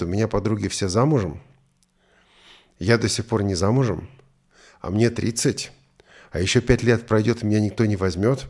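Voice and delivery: ordinary delivery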